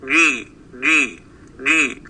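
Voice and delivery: جي with the j from mid-tongue, repeated; jeem drill. Shown as a demonstration. Teacher drilling the Quranic jeem with students. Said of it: "فندرب أنفسنا فنقول".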